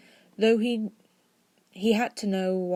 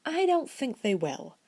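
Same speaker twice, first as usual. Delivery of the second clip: confident; 8 semitones higher than usual. Acted. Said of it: "more confidently than he felt".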